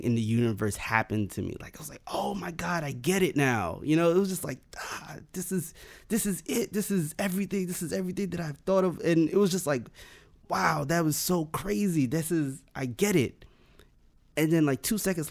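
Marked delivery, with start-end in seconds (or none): 2.1-9.0 higher, breathless wonder at understanding everything; rising into ecstatic realisation, speeding up; awed revelation. Retold or imitated. Retold moment of cosmic understanding. 10.5-13.4 amazed wonder. Retold or imitated. Retold amazement at revelation.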